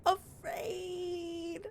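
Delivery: high, drawn-out scared critter voice; pitiful high possum voice; frightened possum. Acted. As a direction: stretch it out high like a timid little animal.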